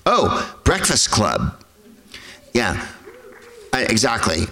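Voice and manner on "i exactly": ordinary talk, nothing marked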